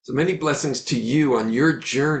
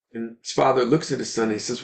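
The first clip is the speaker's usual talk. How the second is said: normal talking voice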